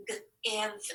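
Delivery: word broken into separate sounds, g then iv; sounded out in parts. Shown as a demonstration. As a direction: sound it out, pausing between onset and ending. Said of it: "tap this with me".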